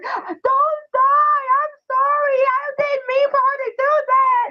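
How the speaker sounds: put-on very high, pleading child's wail; hammed-up tearful little boy begging; imitating crying boy. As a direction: mimic a boy sobbing over his toy. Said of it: "he was crying".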